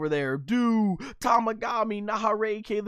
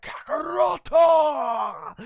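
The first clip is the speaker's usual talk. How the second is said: slow, loud, higher Kakaroto; Vegeta shout; 9 semitones higher, 5 dB louder, much slower than usual. Retold or imitated. Vegeta yelling at his rival, slow shout. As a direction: bellow it like an angry rival. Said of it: "Vegeta's like".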